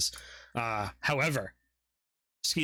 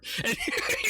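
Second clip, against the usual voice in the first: squealing giggle; high burst of laughter. Real, not acted. GM laughs at gore.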